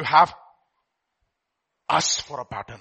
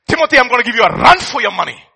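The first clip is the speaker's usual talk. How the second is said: playful boast; 6 semitones higher, 11 dB louder, faster than usual. Real, not acted.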